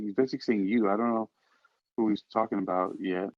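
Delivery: ordinary talk, nothing marked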